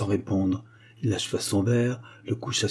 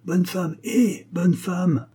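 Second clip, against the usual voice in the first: high, raised call down the stairs; 8 semitones higher, much slower than usual. A narrator's reading. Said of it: "sortit et cria dans l'escalier".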